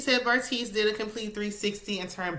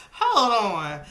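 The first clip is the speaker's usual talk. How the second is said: laughing hold on; 3 semitones higher, 7 dB louder than usual. Real, not acted. Laughing at the show.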